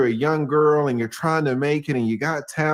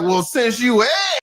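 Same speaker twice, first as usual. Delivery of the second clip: higher, louder smug reply; 8 semitones higher, 3 dB louder than usual. Retold or imitated. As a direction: answer like a pleased sleazy mogul. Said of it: "Puffy's like".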